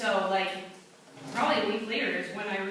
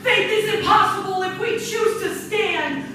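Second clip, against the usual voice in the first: spoken-word poem, building from measured to loud and fervent; slam poetry recitation; 9 semitones higher, 9 dB louder than usual. Acted. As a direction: perform it like a slam poet rising to a passionate climax. Performs her slam poem.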